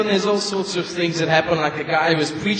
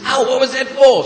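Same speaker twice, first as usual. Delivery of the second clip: higher, louder hurt cry; indignant yelp; 6 semitones higher, 5 dB louder than usual. Acted. Husband just smacked by his wife, louder higher yelp.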